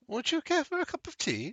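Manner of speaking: high, lilting woman's character voice; high-voiced polite hostess; courteous tea-drinking stranger. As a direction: offer it politely, pitched well up.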